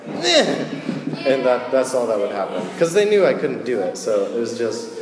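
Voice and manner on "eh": mock failed-stunt grunt. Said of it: "I would just be like".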